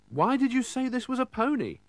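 a puzzled vet's question. Acted. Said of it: "the vet asked".

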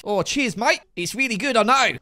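hammed-up Aussie accent, pleased with itself; Pearl. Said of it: "great Australian accent".

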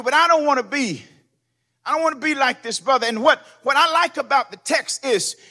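ordinary talk, nothing marked

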